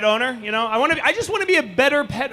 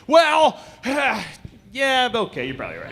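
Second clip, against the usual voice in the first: caving in. Retold or imitated. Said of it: "I'm like".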